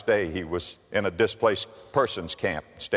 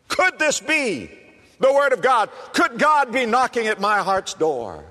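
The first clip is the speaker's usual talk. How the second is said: high, loud preacher's cry; preaching at full force; 13 semitones higher, 4 dB louder than usual. Acted. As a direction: throw the questions at the rafters. Preacher builds to a shout.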